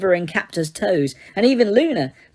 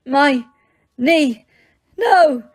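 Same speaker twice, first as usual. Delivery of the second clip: gagged shout; 7 semitones higher, 4 dB louder, much slower than usual. A narrator's reading. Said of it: "shouted Ron through his gag".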